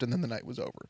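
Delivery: ordinary delivery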